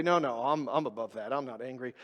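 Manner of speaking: hushed self-righteous denial; mock lofty denial. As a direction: murmur the denial as if too good for anger.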